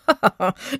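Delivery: dismissive ha-ha-ha; amused laugh. Acted. Old lady to policeman, disbelieving laugh. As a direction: laugh off an absurd idea.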